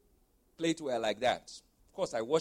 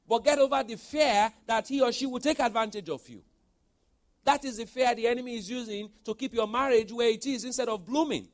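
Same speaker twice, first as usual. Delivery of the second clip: loud, high preaching push; 7 semitones higher, 6 dB louder than usual. Acted. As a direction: drive it home to the congregation. Preaching at full force.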